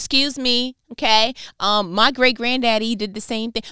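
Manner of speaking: indignant sass. Pretend. Indignant imagined descendant speaking.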